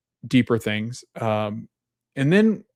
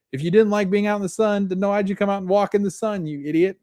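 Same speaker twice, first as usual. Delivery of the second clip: higher-pitched mock rant at a stranger; mock heckling; 10 semitones higher than usual. [name] heckles a covered-up hiker in the sun, mock rant. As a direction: rib them in a raised, incredulous pitch.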